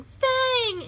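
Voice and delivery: high, loud call across the woods; yelling a name. Acted. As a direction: call out after the runaway pet. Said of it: "she's yelling".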